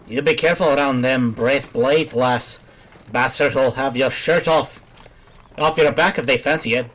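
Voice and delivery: put-on light Irish accent on the old peddler. Reading the peddler's lines to the lass, light Irish accent. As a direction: talk like a folksy Irish trader. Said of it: "giving an Irish accent to the guy".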